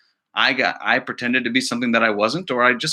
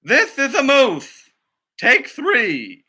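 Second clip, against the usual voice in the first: slate announcer; 9 semitones higher, much slower than usual. Acted. Teacher calling the slate for take two, higher. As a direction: call the take number for the crew.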